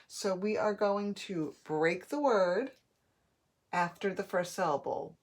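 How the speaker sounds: ordinary talk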